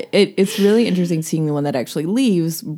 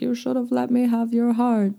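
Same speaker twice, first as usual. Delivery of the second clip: higher, lightly voiced doddering old woman; a hint of a frail old witch pleading; 8 semitones higher than usual. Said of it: "doddering old woman saying".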